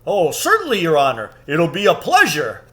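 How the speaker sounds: lightly voiced obliging giant; keen to please; eager giant. A narrator's reading. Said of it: "said Giant Rumblebuffin".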